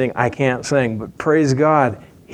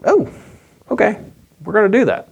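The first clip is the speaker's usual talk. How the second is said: higher, brightly agreeing townsfolk; eager Ninevites; 9 semitones higher, faster than usual. Retold or imitated. Ninevites agreeing to the prophet, higher and eager. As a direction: chirp it like quick yes-men. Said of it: "And they're like".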